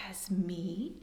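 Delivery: hushed amazed whisper. Acted. Horse marvelling at the big card, whispered. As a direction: breathe it in wonder.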